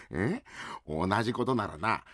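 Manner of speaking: normal talking voice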